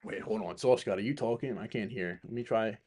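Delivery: usual speaking voice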